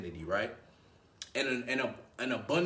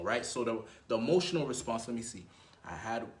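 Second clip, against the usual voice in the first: ordinary talk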